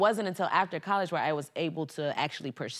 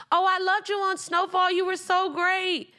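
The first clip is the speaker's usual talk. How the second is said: gushing fan voice; 11 semitones higher, 4 dB louder than usual. Retold or imitated. Gatekeeper gushing over Snowfall.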